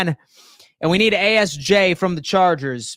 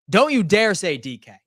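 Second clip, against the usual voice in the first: normal talking voice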